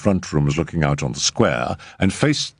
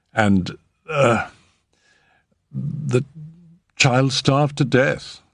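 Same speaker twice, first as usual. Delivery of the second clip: higher, slow and offhand, like a weather report; matter-of-fact; 8 semitones higher, much slower than usual. A narrator's reading. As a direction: say it as if it were nothing. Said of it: "in the same tones as he might have announced that it had just begun to rain".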